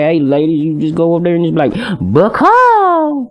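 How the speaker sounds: high-pitched run-on country rambling; country accent. Shown as a demonstration. Coaching a buddy on talking to ladies, high country accent. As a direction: ramble high in country talk, leaping up on the last word. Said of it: "talking in a country accent".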